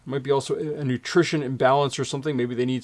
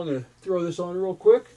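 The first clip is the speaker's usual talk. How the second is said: usual speaking voice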